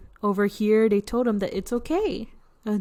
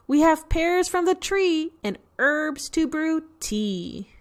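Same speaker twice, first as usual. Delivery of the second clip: usual speaking voice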